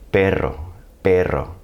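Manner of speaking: "Perro" with a full trill; rolled double R. Shown as a demonstration. Teacher adds word around the trill, rolled Perro. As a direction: roll the R in "Perro". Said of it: "add some word around it".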